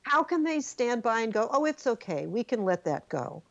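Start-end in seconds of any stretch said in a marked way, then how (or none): none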